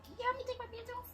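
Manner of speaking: high, fast whiny plea; whiny imitation. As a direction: mimic her as a helpless whiner. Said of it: "I don't sound like that".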